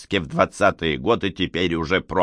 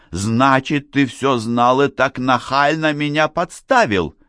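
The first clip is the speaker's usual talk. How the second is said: raised-pitch, building indignation; ominous accusation; 6 semitones higher, 4 dB louder than usual. A narrator's reading. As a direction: accuse with mounting menace. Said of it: "зловеще продолжила Надежда". (с нарастающей угрозой и обидой)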